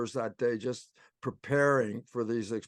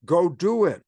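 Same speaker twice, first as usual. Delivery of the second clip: bright eager reply; 6 semitones higher, 9 dB louder, slower than usual. Retold or imitated. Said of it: "And it was like".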